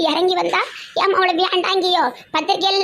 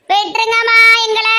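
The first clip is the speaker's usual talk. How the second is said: loud sing-song wail, higher and louder; keen it out like a grieving mother; loud lament of a desperate mother; wailing plea; 4 semitones higher, 5 dB louder than usual. Acted. Mother-in-law wailing her plea.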